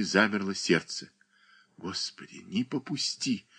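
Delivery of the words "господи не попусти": whispered prayer. A narrator's reading. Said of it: "шептали её уста".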